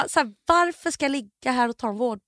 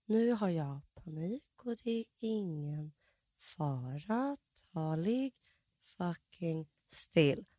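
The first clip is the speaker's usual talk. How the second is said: slow, much softer inner voice; slow-motion thought; 4 semitones lower, 11 dB quieter, much slower than usual. Retold or imitated. Panicking in the scanner, talking herself still, slow and soft. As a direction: drag it out quietly like time has stopped. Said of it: "tänker jag i slow motion".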